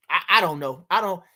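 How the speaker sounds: ordinary delivery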